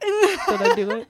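put-on high, slow giggly kid voice; a little kid begging for approval; nasal kid voice. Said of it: "Who's very nasally".

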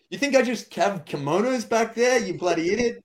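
high, loud mock rant; hammed-up angry shout; mock furious yell. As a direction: yell it like you're fed up with him. Woman yells at him on stage.

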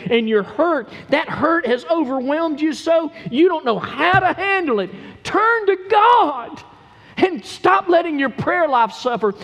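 high, loud, urgent exhortation; impassioned pulpit appeal; full-force preaching. Real, not acted. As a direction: preach it hard to the congregation, then ease off at the end.

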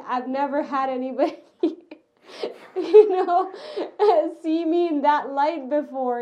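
laughing through the words in real disbelief. Real, not acted. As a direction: break into laughter mid-sentence. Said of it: "I was so shocked at his expression change that I laughed".

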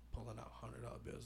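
normal talking voice

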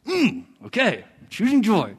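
reluctant, dragged-out okay; grudging agreement. Retold or imitated. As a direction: agree through clenched reluctance. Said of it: "when I heed the infallible voice".

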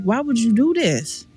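retold crying. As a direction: plead lightly, imitating someone in tears. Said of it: "he bust out crying".

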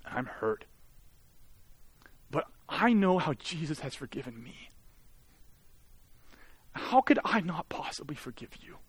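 retold tearful wife. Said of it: "through her tears, she said this".

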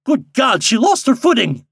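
high, loud burst of shock; alarmed exclamation. A narrator's reading. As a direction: cry out at a sudden fall. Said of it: "exclaimed Mister Kravitz".

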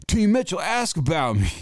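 high-pitched hollered boast, mimicked; mock yelling. Skier retelling a stranger's lift-line boast, mock yelling. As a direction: imitate a loudmouth yelling across the lift line. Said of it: "this dude was yelling".

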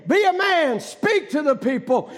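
fiery pulpit exhortation; preaching at full force. Acted. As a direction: drive them forward, preacher. God's rebuke, preached.

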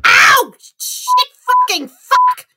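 high, loud cry of pain, cursing; pained yelp and swearing. Acted. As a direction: yelp and curse after the bump. Hits head on ceiling.

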